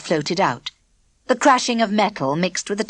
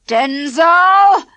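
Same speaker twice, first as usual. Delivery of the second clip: high, loud furious yell; thundering shout; 10 semitones higher, 9 dB louder than usual. A narrator's reading. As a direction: thunder the name. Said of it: "thundered Spag in a terrible voice".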